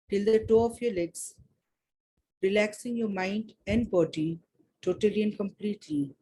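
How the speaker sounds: calm guiding voice, easing them in; guided meditation. Acted. Leads a meditation.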